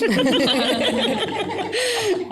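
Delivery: bursting into a high laugh; a real laugh breaking out. Real, not acted. Laughing at her own gripe about talky hosts, a high laugh.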